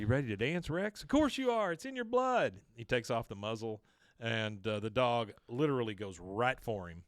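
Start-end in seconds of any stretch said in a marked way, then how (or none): 0.0-2.5 high-pitched, eager pep talk to a dog; hyped-up egging on. Acted. Bill urging his dog to dance, hyped up. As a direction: goad the dog with a rising voice.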